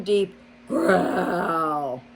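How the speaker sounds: ordinary delivery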